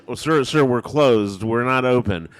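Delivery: mock worker calling back over the order; drive-through worker.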